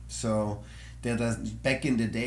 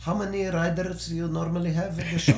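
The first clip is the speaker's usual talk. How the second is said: put-on Arabic accent; 7 semitones higher, 4 dB louder than usual. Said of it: "I love your Arabic accent".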